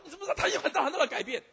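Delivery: excited retelling. Retold or imitated. Student excitedly retells the change.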